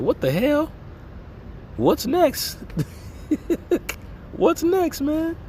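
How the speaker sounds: exasperated disbelief. Retold or imitated. Preacher recalling strange happenings, with a high incredulous cry. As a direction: throw it up high like you can't take another surprise. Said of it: "exasperated at strange events".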